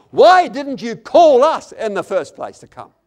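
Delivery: loud, high angry shout, drawn out; furious reproach. Retold or imitated. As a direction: hurl it at him in wrath. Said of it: "poured out their fury and wrath".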